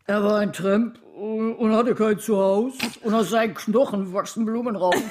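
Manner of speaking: put-on hum-speak it like an old-time crooner. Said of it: "es sollte ein Hans Albers werden".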